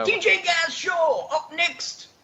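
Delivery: high Scottish DJ announcement; DJ Tom. Retold or imitated. Host imitating a DJ announcing the next show, high Scottish accent. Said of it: "sometimes he yells it too".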